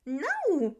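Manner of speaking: put-on a high, loud, happy kid's "No!"; a kindergartner shouting his answer; loud child's cry.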